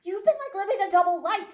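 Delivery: very high, loud playful accusation; mock astonishment. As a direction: act out a client's amazed discovery. Client's amazed discovery, retold.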